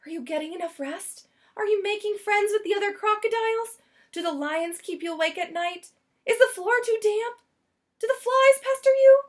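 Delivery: high, anxious motherly fussing; fussing Missus Prim. Acted. Missus Prim fretting over Lyle at the zoo, high and anxious. Missus Prim's string of questions.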